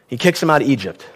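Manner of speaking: normal talking voice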